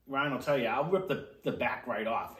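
pizza in mouth; words muffled by food; talking while chewing. Real, not acted. Seen 2: Man holds pizza slice at mouth, seemingly chewing, throughout labelled words.

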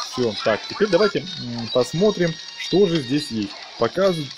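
usual speaking voice

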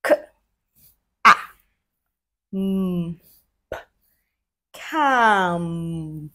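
each sound said apart, then the word; segmented blending. Shown as a demonstration. Teacher showing kids how to blend, sound by sound. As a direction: pause between K, ah, mm, then say can. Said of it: "like this".

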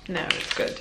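ordinary delivery

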